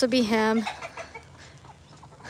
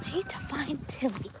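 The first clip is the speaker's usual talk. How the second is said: breathy whisper, hushed; 14 dB quieter than usual. Real, not acted. Owner searching for her goat, whispering to viewers. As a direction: whisper it so the goat won't hear.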